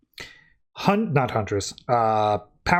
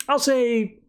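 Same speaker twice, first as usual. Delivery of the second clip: sudden high, stretched-out stall; hedging before giving the score, pitched up; drawn-out high hedge; 10 semitones higher than usual. Real, not acted. Reviewer stalling on his score, high and drawn out. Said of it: "I'll say seven point five".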